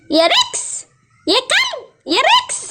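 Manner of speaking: high, rising summons; calling out. Acted. Princess summons her dog from afar, calling out. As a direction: call the dog with a high lift at the end.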